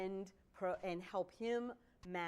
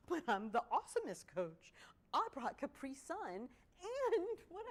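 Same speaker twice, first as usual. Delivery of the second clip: high, slow mock outrage; mock indignant; 8 semitones higher, 4 dB louder than usual. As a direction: ham up being offended. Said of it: "It's like".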